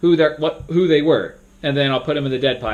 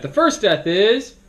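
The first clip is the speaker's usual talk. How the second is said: high, dragged-out dramatic announcement; suspenseful moderator; 8 semitones higher, 4 dB louder, slower than usual. Shown as a demonstration. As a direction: build suspense like a game host. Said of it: "you don't wanna make it very suspenseful".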